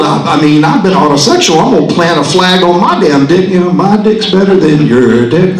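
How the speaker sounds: usual speaking voice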